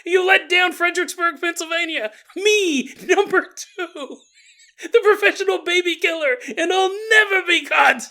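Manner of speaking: put-on high, loud, cackling old villainess; gleeful villain. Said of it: "She's like".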